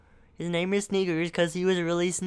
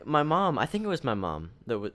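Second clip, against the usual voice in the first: usual speaking voice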